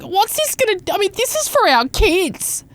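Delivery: hammed-up impression of the reality show mum; mock whiny mum. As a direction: parody her in a high, prissy voice. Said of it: "And she's like".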